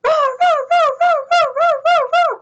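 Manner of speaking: high, rapid-fire string of roars; playful high dinosaur roars; dinosaur roaring. Acted. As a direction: roar over and over, excitedly. Dinosaur roars over chicks.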